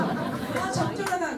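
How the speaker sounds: high-pitched, pleased little remark; mock satisfaction. Shown as a demonstration. User admiring a modest amount of cleanser, mock satisfied. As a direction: lift the pitch in pretend contentment. Demonstrates the wrong satisfied reaction.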